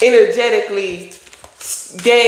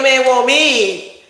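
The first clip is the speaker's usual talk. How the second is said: sassy taunt; 6 semitones higher, slower than usual. Acted. Sassy taunt at a rival woman.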